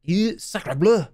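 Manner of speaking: slow, drawn-out French exclamation; French accent. Pretend. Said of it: "say in a French accent".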